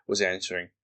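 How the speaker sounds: ordinary delivery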